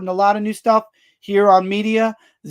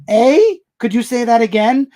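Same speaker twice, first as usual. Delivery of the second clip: higher, louder questioning "eh?"; imitating puzzled viewers; 3 semitones higher, 5 dB louder than usual. Streamer imitating viewers who can't hear him, puzzled and louder. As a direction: mimic someone who didn't catch it. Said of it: "people on the live stream are going".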